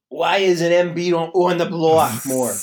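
loud, badly faked Philly fan; hammed-up Philly accent complaint; terrible Philly accent. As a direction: do a bad Philadelphia accent, griping. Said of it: "that was a terrible Philly accent".

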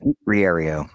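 first name swallowed in a rush; hurried, run-together name; rushed through. Real, not acted. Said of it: "you said it so fast, your, your first name disappeared".

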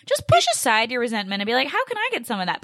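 high-pitched incredulous parody of advice; heated mocking quote. Host quotes unhelpful article advice back, heated mockery. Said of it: "this gets me heated".

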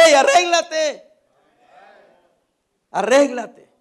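loud, drawn-out preacher's shout; shouted warning. Acted. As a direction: call them out at full force, stretching the words. Gives God's warning as a direct shout. (advertencia a gritos)

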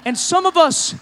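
loud, high preacher's build; fervent revival cry; preaching at full force. Acted. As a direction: drive it home to the congregation.

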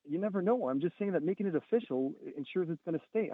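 ordinary talk, nothing marked

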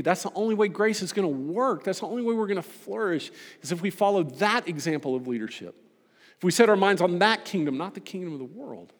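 rally the congregation toward the kingdom; preaching. Acted. Preaching builds to exhortation.

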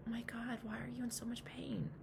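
hushed, half-whispered question; soft whispery sympathy; tender concern. Acted. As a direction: ask very softly, with tender concern. Answers her hurting inner alcoholic tenderly.

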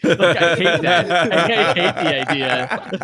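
loud, slow laughing groan; laughing disgust. Real, not acted. Friends joking about Sonic, loud slow laughing dismay. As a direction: laugh through real horror at it.

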